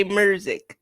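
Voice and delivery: MUSIC drawn out as "murzic"; Memphis pronunciation. Shown as a demonstration. Memphis guest showing the host how they say it, Memphis pronunciation. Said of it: "I don't use it with the R, but we do say".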